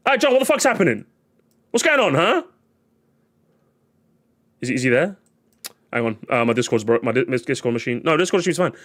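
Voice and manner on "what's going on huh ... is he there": ordinary talk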